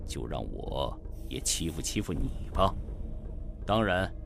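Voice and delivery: low, unhurried voice of an unbothered master; indifferent, cool. A narrator's reading. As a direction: toy with a weaker opponent coolly. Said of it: "淡淡地说".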